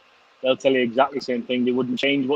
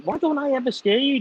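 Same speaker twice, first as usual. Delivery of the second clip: put-on much higher, childlike whine to his dad; young son's voice; 12 semitones higher than usual. Son asks his dad why he never gets scared, high childlike voice. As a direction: play the eleven-year-old asking. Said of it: "he's like".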